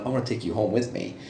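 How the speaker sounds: soft, quick flirty murmur; flirty quote. Retold or imitated. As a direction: tease like a tipsy flirt.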